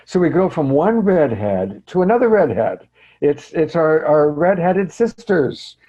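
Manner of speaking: high, loud, delighted handover; playful host introduction. Real, not acted. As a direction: announce the next guest with big playful energy. Host playfully hands over to next speaker.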